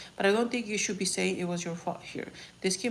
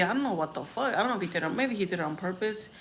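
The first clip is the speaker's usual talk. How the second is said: normal talking voice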